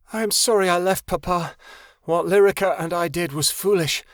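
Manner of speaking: ordinary delivery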